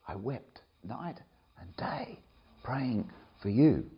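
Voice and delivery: slow whisper of tears; whispered lament. Retold or imitated. Paul telling his friends on the beach, whispered. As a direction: whisper it like grieving. Paul's tearful farewell.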